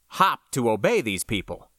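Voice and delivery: plain reading voice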